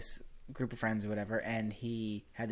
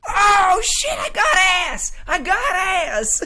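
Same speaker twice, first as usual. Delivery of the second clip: very high, full-volume comic yell; mock horrified shout; 14 dB louder, slower than usual. Crazy friend licking his tongue and realizing, mock horrified shouting. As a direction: yell it out, dragging the last words.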